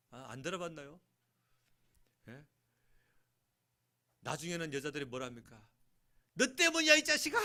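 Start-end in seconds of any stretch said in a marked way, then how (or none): 6.3-7.4 much higher, louder laughing mock yell; mother in labor screaming at her husband, half laughing; wife yelling in labor. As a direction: ham up a furious wife blaming him.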